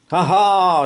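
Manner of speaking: high, loud gleeful ha ha; triumphant shout. Acted. Boy gloats at the stuck mammoth, loud and high. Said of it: "shouted George".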